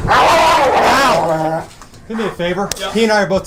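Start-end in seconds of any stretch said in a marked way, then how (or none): 0.1-1.7 pained ow. Real, not acted. Dog biting the handler.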